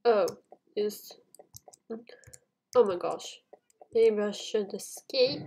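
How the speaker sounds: normal talking voice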